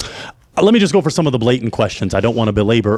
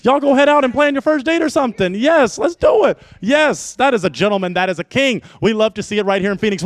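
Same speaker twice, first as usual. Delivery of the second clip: hype host; 8 semitones higher than usual. Acted. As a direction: pump up the crowd like an MC closing the show. Host wraps up the show.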